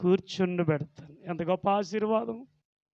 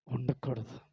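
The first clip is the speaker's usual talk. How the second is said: usual speaking voice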